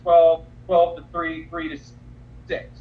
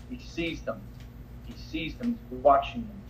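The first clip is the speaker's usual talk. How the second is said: hushed; 3 semitones lower, 7 dB quieter than usual. Acted. Dramatic hush in sermon.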